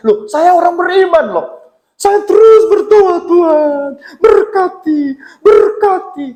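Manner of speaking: high, insistent mock plea to God; building into high-pitched, exaggerated pleading; mock insistent praying. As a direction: mimic a believer begging loudly for blessings. Mimics believers insistently praying for blessing.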